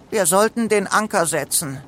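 high-pitched, resigned suggestion; sighing. A narrator's reading. Paddy at the wheel in evening, mildly resigned. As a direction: offer it with a touch of a sigh. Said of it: "Paddy seufzte".

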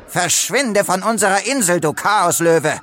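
high, forceful order to leave; angry banishing. A narrator's reading. Crocodile throws Leo off the island, angrily.

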